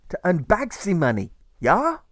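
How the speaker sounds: high, bright put-on voice rising on yeah; a cheery offer from an older mate; German friend voice. A narrator's reading. The German friend offers Alfred Saturday work. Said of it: "the good German suggests".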